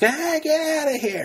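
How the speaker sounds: high, loud impression of a coach ejecting people; mock dismissive yell. Coach throwing reporters out of practice, mock yell. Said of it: "he's like".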